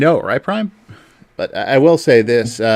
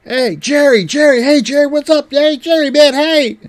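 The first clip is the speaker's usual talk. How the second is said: crowd calling out; 7 dB louder than usual. Retold or imitated. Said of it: "they all start going".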